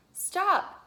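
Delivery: loud high shout. A narrator's reading. Volunteer shouting at George on the cart. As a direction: yell it to halt a runaway cart. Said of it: "a library volunteer shouted".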